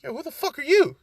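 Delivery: lightly voiced irritated rival; pissed-off Ida. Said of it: "Ida pissed because he's just like".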